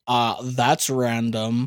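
slow, low, drawn-out mockery; mock "random" catchphrase. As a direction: drag it out like a tired catchphrase. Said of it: "everyone would be like".

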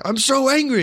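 high hammed-up fake fury; mock angry customer. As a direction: squeal out the outrage like a cartoon.

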